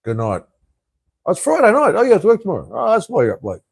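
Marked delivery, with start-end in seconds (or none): 1.2-3.6 mock surprise. Real, not acted. Reading chat, mock realisation.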